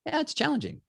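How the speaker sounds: very high, light dismissal; breezy brush-off. Retold or imitated. Performer deflecting a question about his mom, high and breezy. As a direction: toss it off to skip the topic. Guy could have brushed it off.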